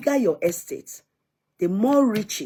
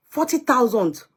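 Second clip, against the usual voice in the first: ordinary talk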